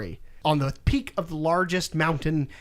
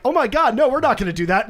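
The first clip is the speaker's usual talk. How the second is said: higher, louder mock-panicked kid; alarmed son; 6 semitones higher, 5 dB louder, faster than usual. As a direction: protest like a startled boy. Son protests in retold scene.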